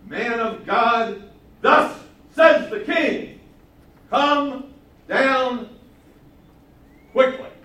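slow, high, loud royal command; commanding shout. Retold or imitated. Captain shouting the king's order up to Elijah, loud and slow. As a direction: bellow it up the mountain, drawn out and harsh.

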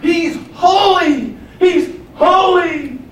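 high, loud, drawn-out repeated cry; slow, soaring shout of praise; emphatic proclamation. Acted. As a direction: stretch each holy into a loud call. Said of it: "is for emphasis".

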